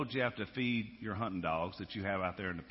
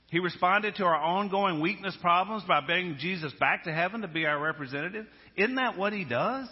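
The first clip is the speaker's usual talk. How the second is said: louder, higher preacher's cry; preaching at full force; 8 semitones higher, 6 dB louder than usual. Acted. Preacher to congregation, ringing full-force preaching. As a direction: drive it home to the congregation. Preacher building on Christ's response.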